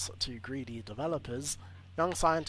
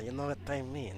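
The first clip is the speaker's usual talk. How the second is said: normal talking voice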